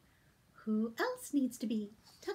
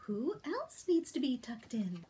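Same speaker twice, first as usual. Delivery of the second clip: ordinary delivery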